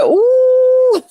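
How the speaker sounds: long, high-pitched swooning ooh; delighted ooh. Retold or imitated. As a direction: draw out a high ooh of pleasure. Delighted reaction to the playback.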